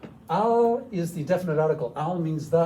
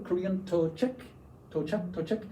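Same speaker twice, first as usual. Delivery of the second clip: normal talking voice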